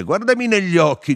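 very loud, high cry; repeated shouted order. A narrator's reading. Narrator repeats his order to Firmo, shouting. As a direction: insist at full voice. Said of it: "gli ripetei".

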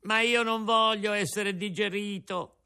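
high tearful cry. A narrator's reading. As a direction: protest on the edge of tears. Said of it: "urlò Pinocchio ricominciando a piangere". (grido piangente, voce alta)

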